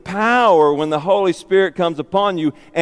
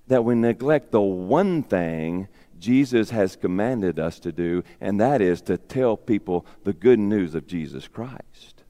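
low, hushed emphasis; 11 semitones lower, 6 dB quieter than usual. Acted. Solemn point of sermon.

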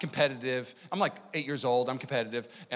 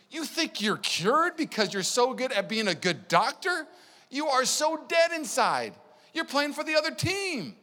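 stern rebuke; 10 semitones higher, slower than usual. Retold or imitated. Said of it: "he's saying to the Pharisees".

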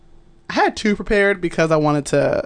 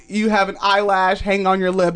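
usual speaking voice

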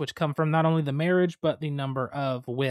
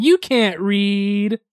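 loud, drawn-out, higher jeer; mocking call-out; 5 semitones higher, 7 dB louder, much slower than usual. Calling out a party guest for reading, mocking. Acting out the mocking card scene.

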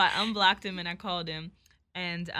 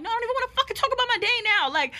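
high, fast, loud re-enacted rant; acting out a furious, rapid-fire protest; retold pissed-off outburst; 10 semitones higher, 6 dB louder than usual. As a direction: blow up at him, voice jumping way up. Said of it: "'cause I'm just fucking pissed".

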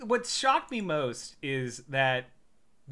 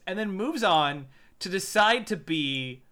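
normal talking voice

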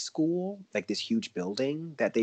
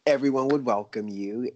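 "Everyone would welcome you" said louder and higher; louder restart; 4 semitones higher, 7 dB louder than usual. Real, not acted. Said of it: "Starting fresh".

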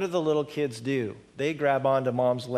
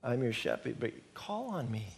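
softer, gentle and unhurried; calm invitation; 2 semitones lower, 8 dB quieter than usual. Acted. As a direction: invite softly, no pressure. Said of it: "just calmly there saying".

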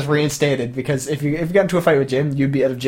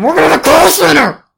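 much higher, loud overexcited rant; laughing shout; 13 semitones higher, 10 dB louder than usual. Real, not acted. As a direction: blurt it out, worked up. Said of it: "calm down".

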